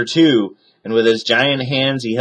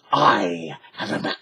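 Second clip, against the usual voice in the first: secret whisper; 4 dB quieter than usual. A narrator's reading. Secretive pirate.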